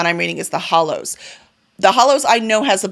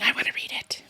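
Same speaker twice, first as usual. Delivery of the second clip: whisper; 10 dB quieter than usual. Pretend. Book talking to her.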